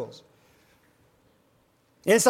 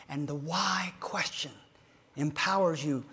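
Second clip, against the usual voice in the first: whispered aside; 6 semitones lower, 9 dB quieter than usual. Real, not acted. Confiding aside.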